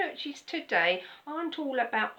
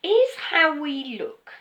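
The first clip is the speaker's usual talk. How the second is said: slower, loud and pointed; sudden loud emphasis; 4 semitones higher, 10 dB louder, slower than usual. Acted. Preacher booms the question at the congregation. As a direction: hit it hard to grab attention.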